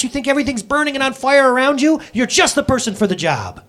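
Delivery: ordinary talk, nothing marked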